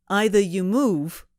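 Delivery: put-on thick New England accent. A narrator's reading. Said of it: "thick New England accent".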